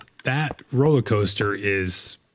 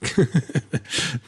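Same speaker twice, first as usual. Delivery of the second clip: high, airy ghost-lady laugh; imitated eerie giggle; ghostly laugh; 5 semitones higher than usual. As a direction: laugh like a floating spirit. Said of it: "that little sing-songy voice".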